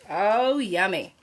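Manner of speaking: plain reading voice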